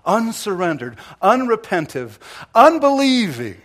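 loud, climbing sermon cry; preaching at full force. Acted. Preacher urging the church at the top of his voice. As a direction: build the point up to a shout.

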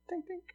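very high, light ding ding; tiny coin ding. Retold or imitated. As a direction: make two tiny coins chime. Widow drops two coins.

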